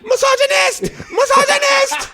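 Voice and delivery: high laughing shout; squealed teasing accusation; mock accusation. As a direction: yell it in fake outrage, cracking up.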